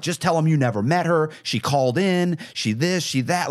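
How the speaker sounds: feed them the lie fast and eager; urgent scheming. Retold or imitated. To the couple he had refinanced, urgently pitching a cover story.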